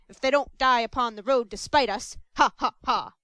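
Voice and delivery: loud, smug explanation ending in a laugh; callous beadle. Acted. Mr Bumble explaining to Mrs Mann, smug and loud. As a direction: gloat over the cheap savings.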